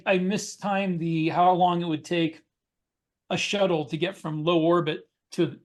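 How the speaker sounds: ordinary talk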